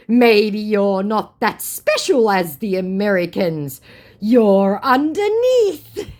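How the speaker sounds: high, loud, slow and overblown; mocked scammer. Scammer ranks her below Americans, mocking. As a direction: ham it up until laughter nearly breaks in.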